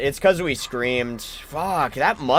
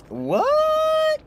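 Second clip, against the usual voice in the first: shocked what; 3 dB louder than usual. Real, not acted. Shocked at the perk screen.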